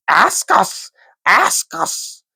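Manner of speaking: high, hissing creature voice; a creepy little creature begging to be asked; Gollum voice. A narrator's reading. Gollum pleading with Bilbo, high and hissing. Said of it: "said Gollum".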